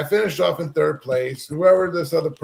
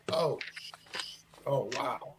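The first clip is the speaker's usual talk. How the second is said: stunned; 2 semitones lower, 12 dB quieter than usual. Retold or imitated. Realizes he hit a child.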